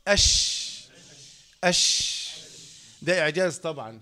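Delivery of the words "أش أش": "أش" with a long rushing sh; isolated "أش", hiss sustained; shin articulation. Shown as a demonstration. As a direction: let the sh of "أش" spread out as air. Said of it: "الشين".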